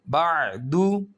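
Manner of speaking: बा दू drawn out very slowly; slow recitation. Shown as a demonstration. Teacher reciting joined syllables slowly.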